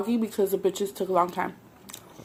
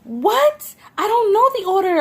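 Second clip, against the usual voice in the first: much higher, louder disbelief; incredulous; 11 semitones higher, 5 dB louder, slower than usual. Retold or imitated. Retelling her shock at his phone call, high and loud. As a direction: shriek your disbelief. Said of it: "I'm like".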